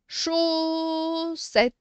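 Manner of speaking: "Chaussette" with a long, held first syllable; teacher slowly sounding out "Chaussette"; stretched syllables. Shown as a demonstration. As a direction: stretch the "Chau" high, then land "ssette". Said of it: "on fait toujours les syllabes".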